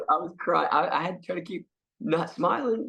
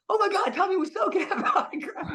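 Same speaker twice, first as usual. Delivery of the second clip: high, gushing fan voice, laughing a little; a fan squealing on seeing a star; starstruck fan. Retold or imitated. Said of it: "somebody comes up like".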